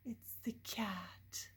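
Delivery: slow whisper. Real, not acted. Reader telling the child who it was, slow whisper. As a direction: reveal it softly. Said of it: "hushed reveal".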